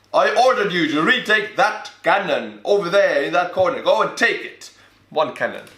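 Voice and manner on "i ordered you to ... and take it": lightly acted officer giving orders, pitched higher; mock commander. Commander ordering troops to fetch one cannon, mock stern. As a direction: play a commander insisting on a trifle.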